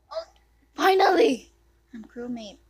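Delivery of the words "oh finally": high, loud triumphant finally; relieved cheer. Real, not acted. Player cheering as the round finally starts. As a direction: cheer at long last.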